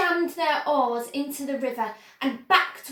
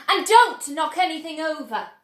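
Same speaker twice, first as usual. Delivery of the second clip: louder warning carried on; firm shouted caution; shouted order; 6 dB louder than usual. Acted. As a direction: keep the alarm going. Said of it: "shouted Mister Wonka".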